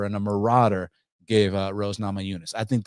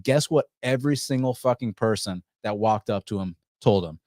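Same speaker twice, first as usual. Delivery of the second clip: usual speaking voice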